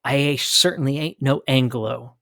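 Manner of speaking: ordinary talk, nothing marked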